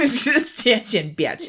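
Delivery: slow, stretched Wisconsin vowels on Stitch and Bitch; Wisconsin accent. Acted. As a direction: draw it out Wisconsin style. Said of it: "I love that accent".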